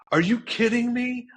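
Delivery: exasperated yelling, reenacted; angry outburst. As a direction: relive the moment you snapped. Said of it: "I just start going off on".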